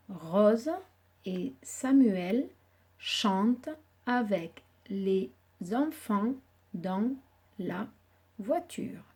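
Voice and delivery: slow, clear dictation. Shown as a demonstration. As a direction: read it slowly for writing. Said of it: "essayer d'écrire cette phrase".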